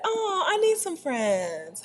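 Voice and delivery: high, slow, soft whine; plaintive. Real, not acted. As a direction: drag it out like a lonely plea. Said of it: "pleading to viewers".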